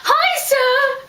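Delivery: loud, high-pitched call; high hailing shout; calling out a greeting. Retold or imitated. Friend calls out to teacher in story.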